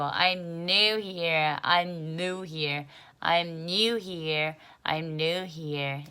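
very slow drill, "new" glided clearly as nyoo; 또박또박 영국식 발음; careful British new. Shown as a demonstration. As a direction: repeat "I'm new here" slowly, nyoo crisp each time. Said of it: "영국식 발음할 때 정확히 해야 돼요".

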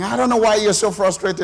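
loud, high-pitched imitated argument; retold shouting match. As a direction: act out an exasperated shout in a car quarrel. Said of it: "we had a shouting match".

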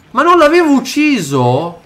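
high, loud frustrated cries; frantic exasperation. Real, not acted. Player unable to kill an enemy, high frantic cries. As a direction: panic as the enemy keeps getting up.

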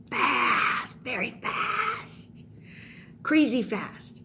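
hammed-up emphasis on "fast"; emphatic exaggeration. Real, not acted. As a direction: stretch "fast" for dramatic effect. Emphasizing the speeding car's pace.

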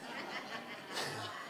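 soft breathy chuckle; a short laugh at the story; laughing softly at the story's punchline; quiet laugh. Real, not acted.

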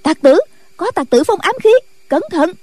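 high, urgent warning cry; alarmed shout. Acted. Young nuns warning of hidden weapons, high alarmed cry. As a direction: raise the alarm, high-voiced. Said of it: "cũng la lên".

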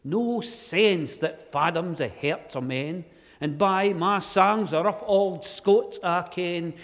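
loud, slow Scots poem recital. Acted.

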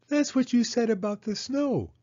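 put-on high child's voice, anxious questions; Penny worrying to Mama, high and anxious; worried little girl. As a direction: fret like a small girl who fears the worst. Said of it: "said Penny".

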